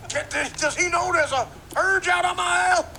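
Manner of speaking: high, loud mock outrage; hammed-up shout of disbelief; astonished onlooker. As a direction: holler it in a squawky put-on voice. Same acted onlooker.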